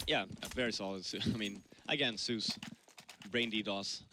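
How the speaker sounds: usual speaking voice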